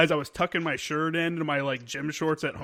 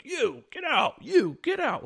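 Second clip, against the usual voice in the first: mock drill-sergeant order, higher; barking officer; 9 semitones higher, slower than usual. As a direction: bark it out like a petty officer.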